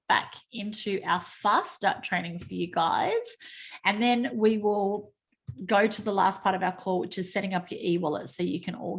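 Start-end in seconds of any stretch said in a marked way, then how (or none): none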